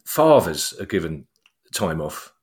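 usual speaking voice

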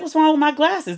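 high-pitched offended retort; affronted. Retold or imitated. Customer defends old glasses to a young assistant, affronted.

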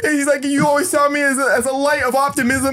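high, shouted confession; mock scream. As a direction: scream it like a drunk friend. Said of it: "He screamed".

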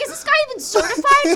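very high, loud, fast disbelief; incredulous. Retold or imitated. Imitating her teen self doubting the doctor, high and fast. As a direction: squeal it in outraged disbelief. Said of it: "I'm like".

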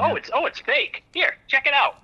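high, easy "check it out" offer; mock careless self. Comic telling himself showing the officers the fake gun, high and naive.

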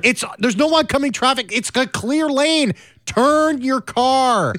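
high exasperated wail, stretching to the end; flabbergasted. Acted. Pleading with a driver who won't turn, flabbergasted. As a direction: wail it, drawing out the last command. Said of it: "I'm flabbergasted".

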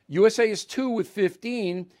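ordinary talk

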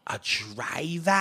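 put-on "driver" drawn out in a Boston accent; put-on Boston accent, dropped final r. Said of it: "peanut butter on that accent".

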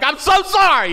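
very high, loud frantic sorry; new driver apologizing to his sick dad, frantic; panicked apology. Retold or imitated. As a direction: blurt it out in panic.

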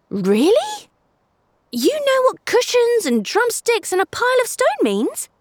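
put-on high young boy, amazed; a kid who can't believe it; eager boy. A narrator's reading. Obi excited by discovery.

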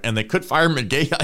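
ordinary talk

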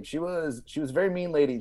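ordinary talk

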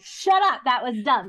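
loud, high playful outburst; mock rude interruption. Threatening to interrupt her friend's story, mock rude. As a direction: jokingly shout down your friend. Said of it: "I'll stop you right in the middle ... and say".

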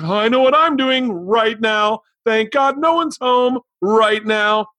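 gleeful like the package just came; giddy glee. Pretend. Buyer opening a delivery at home, giddy. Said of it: "you're like".

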